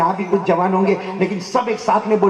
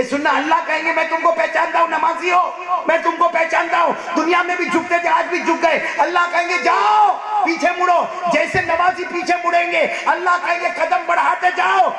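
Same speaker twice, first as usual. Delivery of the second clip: high-pitched, full-force preaching; impassioned divine proclamation; 13 semitones higher, 3 dB louder than usual. Acted. Allah telling praying believers he recognises them, proclaimed with fervour. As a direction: proclaim each phrase high and sustained.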